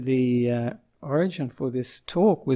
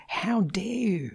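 a higher, slow, mock-indignant "how dare you"; mild imitation of an indignant young activist; imitating Greta Thunberg; 5 semitones higher, 4 dB quieter, slower than usual. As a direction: throw the accusation like an outraged teen activist. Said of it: "and she'd say".

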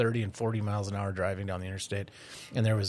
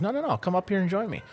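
quick, higher-pitched coaxing; light urging; 8 semitones higher, faster than usual. Acted. Monkey invites lizard up the tree, lightly urging. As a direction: lightly wave off the doubt, pitched up and fast.

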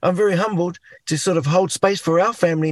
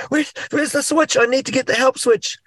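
high, searching half-panic; mock panic; 7 semitones higher than usual. A man acting out hunting for help in the storm, mock panic. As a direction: scramble for the switch in a rising fluster. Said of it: "but you're in a panic going".

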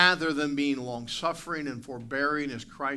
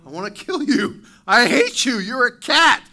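building from plain to loud and higher, snarling; dog's angry thoughts; 8 semitones higher, 13 dB louder than usual. Retold or imitated. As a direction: voice the dog working itself into a rage. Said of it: "He's like".

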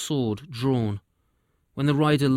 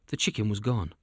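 usual speaking voice

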